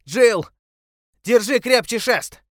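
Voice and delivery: high, loud and slow command; urgent shout. A narrator's reading. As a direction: bellow it over the rapids. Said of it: "закричал он". (громкий крик)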